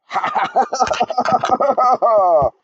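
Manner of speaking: long, high, loud villain cackle; evil laugh. Acted. Villain cackles over his trapped enemy, evil laugh. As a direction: throw back your head and cackle in triumph.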